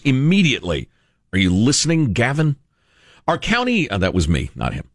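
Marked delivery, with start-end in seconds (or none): none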